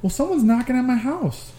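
put-on a worried little boy reporting; imitating the kid. The boy telling him about knocking, high childlike voice. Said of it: "he goes".

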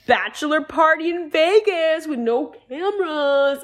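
loud, high mock hype, drawn out at the end; hyped-up party pal. Michael pitching a bachelor party, mock over-excited hype. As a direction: imitate a friend bellowing party plans.

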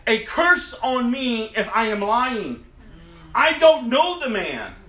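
loud, high, forceful denial; swearing an oath. Acted. Peter swearing to bystanders he doesn't know Jesus, vehement. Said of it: "But Peter swore".

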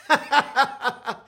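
high rapid burst of laughter. Real, not acted. Comedian amused by his roller coaster joke, high laugh.

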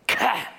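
sharp, high scoffing "ka". Acted. Preacher imitates Pharisees scoffing at Jesus, contemptuous scoff. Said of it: "there's a sound".

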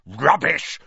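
angry snarling outburst. A narrator's reading. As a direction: snarl it as a scornful dismissal. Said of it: "snarled Filch".

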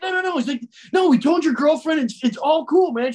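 high, hyped reassurance; excited. Retold or imitated. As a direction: brush it off cheerfully. Friend reassures him.

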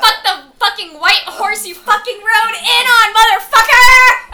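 loud, high, drawn-out acted rant; furious shouted curse. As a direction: belt it out in theatrical fury, building to a roar. Reading out heroine's furious curse.

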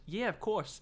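put-on British, pitched high; mock British accent. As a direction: redo it as a chirpy Brit. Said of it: "I'm sorry, he's British".

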